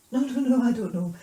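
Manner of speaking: loud, rapid acted denial; panicky denial. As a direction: blurt out a flustered no.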